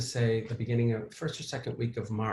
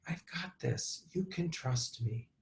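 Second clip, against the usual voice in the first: soft reassurance; 5 semitones higher, 6 dB quieter, slower than usual. Retold or imitated. Reassuring the little boy.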